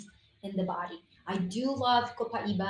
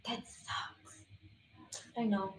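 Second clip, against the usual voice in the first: whispered under the breath; a very soft, whispered aside; hushed aside; 10 dB quieter, slower than usual. Real, not acted. Host to herself during a muting mishap, whispered.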